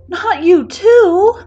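high, loud, drawn-out wail; loud cry. A narrator's reading. Hearing a second friend is leaving, loud cry. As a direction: cry out in dramatic dismay. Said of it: "Kaminari cries loudly".

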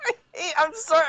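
a hammed-up whimper on "I hate"; mock sob. Host hams up a whimper over an actress he hates. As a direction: fake a little cry of disgust.

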